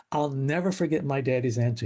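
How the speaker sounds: usual speaking voice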